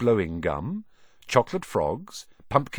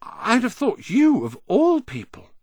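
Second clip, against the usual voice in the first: high, amazed disbelief; shocked and impressed; 12 semitones higher, 4 dB louder than usual. A narrator's reading. Ron amazed that Harry said the name, shocked and impressed. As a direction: pitch it up, astonished at your friend. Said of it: "sounding both shocked and impressed".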